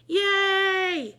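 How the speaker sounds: loud, high cheer. A narrator's reading. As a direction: whoop it out with joy. Kids cheer after yes.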